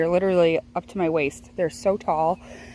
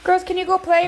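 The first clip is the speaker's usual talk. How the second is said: calling out; 9 semitones higher, 4 dB louder than usual. Real, not acted. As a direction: call across the room. Calls out to children.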